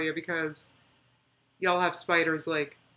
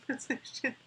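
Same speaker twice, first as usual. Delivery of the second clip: words dissolving into laughter; laughing; 6 semitones higher, 10 dB quieter than usual. Real, not acted. Streamer regretting starting the spider conversation, laughing. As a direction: laugh through the end of the line.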